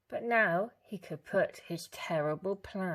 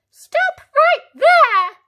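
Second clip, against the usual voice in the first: very high, loud, slow yell; granny bursting in to save her; high furious shout; 22 dB louder, slower than usual. A narrator's reading. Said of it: "yelled Granny".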